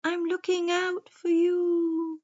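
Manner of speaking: slow, high hooting owl. A narrator's reading. Wise owl warns the forest creatures, slow high hooting. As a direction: draw it out in a high owl hoot. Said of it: "He blinked his big round eyes and said".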